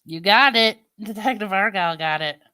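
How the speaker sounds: ordinary talk, nothing marked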